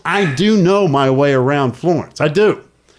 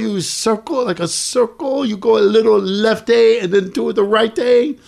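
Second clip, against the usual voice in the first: higher, hammed-up broken English with an Italian accent; fake Italian accent; 7 semitones higher than usual. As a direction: replay the Italian act for the host. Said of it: "pretended like I didn't know English".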